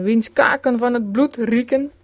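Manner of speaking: ordinary delivery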